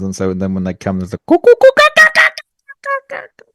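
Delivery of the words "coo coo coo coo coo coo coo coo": very high, rapid-fire kookaburra cackle; kookaburra call. Shown as a demonstration. Co-host explaining the other option with a kookaburra call. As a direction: cackle like a kookaburra, then fade away. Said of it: "the sex sounds are kookaburra sounds".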